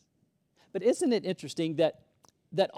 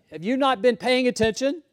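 indignant; 5 semitones higher, 7 dB louder than usual. Retold or imitated. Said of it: "He's like".

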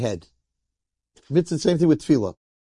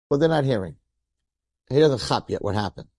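ordinary delivery, nothing marked

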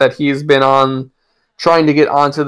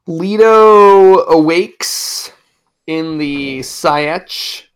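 dramatic reading; 2 semitones higher, 3 dB louder, much slower than usual. Acted.

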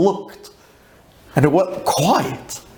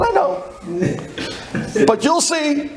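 high-pitched, insistent comeback; defiant retort; 11 semitones higher than usual. Retold or imitated. Passenger to agent about the economy flight, defiant.

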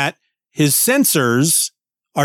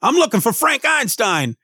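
high, gruff Brooklyn tough-guy robot; a tiny robot doing a New York bruiser; Ben Grimm; 8 semitones higher than usual. Acted. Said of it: "the accent that Allred gives him".

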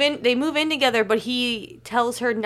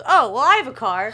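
bright quoting voice; 5 semitones higher, 7 dB louder than usual. Retold or imitated. Retold Annie offering her car.

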